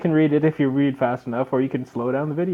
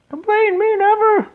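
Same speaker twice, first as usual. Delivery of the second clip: put-on very high, louder falsetto girl; sister's voice; 5 dB louder than usual. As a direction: squeak it as the little sister. Sister's cheeky reply.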